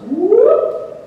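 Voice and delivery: high, loud swooping whoop; sudden whoop. Retold or imitated. Describing the psalmist's sudden turn with a loud whoop. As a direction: mimic a sudden about-face. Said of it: "he just goes".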